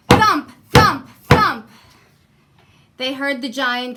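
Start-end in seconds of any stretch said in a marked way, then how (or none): 0.1-1.7 loud, higher stomping words; booming thumps. A narrator's reading. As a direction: boom like heavy giant steps. Giant's footsteps approaching.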